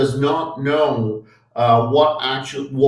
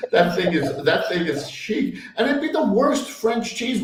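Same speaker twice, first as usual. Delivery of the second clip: half-laughing through the quote; 6 semitones higher than usual. Retold or imitated. Mocking mom's taste in cheese, laughing.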